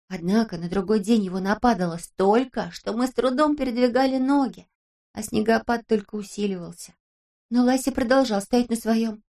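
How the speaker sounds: plain reading voice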